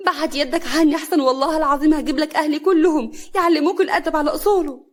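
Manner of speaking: high, loud protest in pain. A narrator's reading. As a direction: cry out at him to let go, threatening. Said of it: "هنا بألم".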